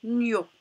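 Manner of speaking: "nio" as one syllable, gn plus o; syllable demonstration. Shown as a demonstration. Teacher showing pupils how gn and o combine, "nio" said distinctly. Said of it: "ça se prononce".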